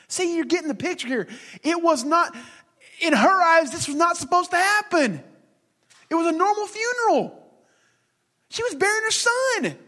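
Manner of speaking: high, climbing preacher's story; high preaching build. Acted.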